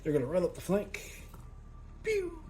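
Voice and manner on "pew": high, light toy-gun pew; mock laser pew. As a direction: fire off a playful shot noise. Shooting sound effect for miniatures.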